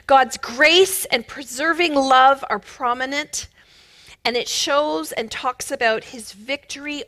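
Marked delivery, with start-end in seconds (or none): none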